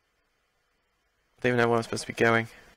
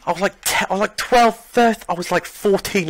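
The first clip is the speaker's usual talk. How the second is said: high, louder, disbelieving rant; indignant rant; 7 semitones higher, 4 dB louder than usual. Real, not acted. Gamer ranting that he was far above its level, higher and louder. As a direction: climb up in outrage.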